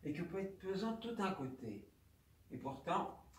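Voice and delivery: ordinary delivery, nothing marked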